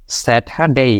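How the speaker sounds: স্যাটারডে ending ডেই, no r; right Saturday. Shown as a demonstration. Teacher models the correct form. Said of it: "এভাবে উচ্চারণটা হবে".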